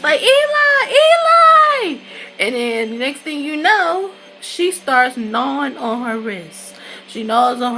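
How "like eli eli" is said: high, loud wailing cry of a name; mimicked scream. Retold character crying out a name.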